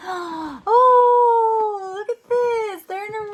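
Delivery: high, loud pretend wonder; mock wonder. Parent showing child the rainbow page, wondering. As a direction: marvel at the page.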